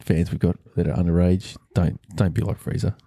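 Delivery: ordinary delivery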